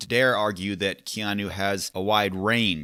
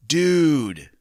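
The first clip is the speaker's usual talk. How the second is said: higher, louder stunned "Dude!"; surfer dude exclamation; 6 semitones higher, 8 dB louder than usual. Pretend. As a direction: blurt it like a wide-eyed surfer. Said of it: "you think for a moment he might say".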